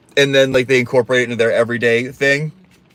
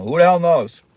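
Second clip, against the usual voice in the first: raised catchphrase; 6 semitones higher, 4 dB louder than usual. Pretend. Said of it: "I can't stop saying".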